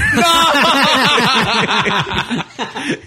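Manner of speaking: high loud laugh; burst of laughter. Real, not acted. Laughing at the open mic guy.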